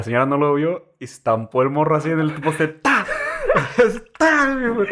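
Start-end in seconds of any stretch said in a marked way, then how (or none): 2.7-3.0 higher sharp smack sound; impact sound. Retold or imitated. Retelling a kid hitting a post. 4.2-4.5 higher sharp smack sound; impact sound. Retold or imitated. Repeating the crash sound.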